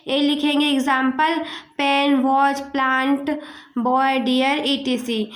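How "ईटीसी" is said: ordinary delivery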